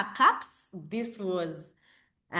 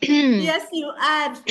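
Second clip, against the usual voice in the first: loud; 7 semitones higher, 10 dB louder, slower than usual. Real, not acted. Host confirms speaker is audible.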